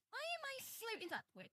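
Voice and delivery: high, startled squeak of surprise; sudden high-pitched alarm. Real, not acted. Avatar suddenly floating upwards on stream.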